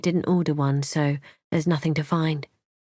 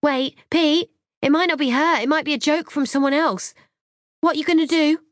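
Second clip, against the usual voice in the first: high, raised voice calling after someone; urgent shouting; 10 semitones higher, 4 dB louder than usual. A narrator's reading. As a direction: shout pleadingly to stop him.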